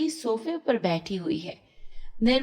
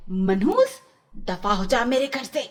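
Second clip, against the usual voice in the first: angry aunt. Acted. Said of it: "चाची रीटा को मारते हुए".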